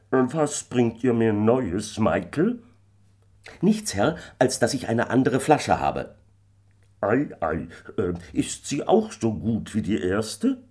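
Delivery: a curious squire, drawing it out; landlord. A narrator's reading. The landlord questions Michael, lordly.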